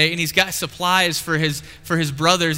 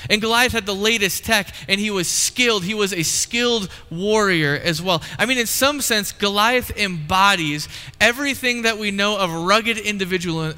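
usual speaking voice

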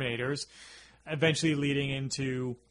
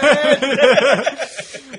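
loud laugh; 10 semitones higher, 12 dB louder than usual. Real, not acted. Big laugh at own bit.

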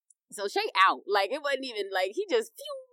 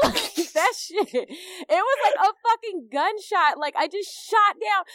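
excited retelling; 9 semitones higher, 4 dB louder, slower than usual. Retold or imitated. Retelling the terrifying drop.

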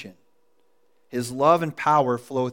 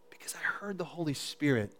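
hushed awe; 12 dB quieter than usual. Real, not acted. Awed recall of the Spirit.

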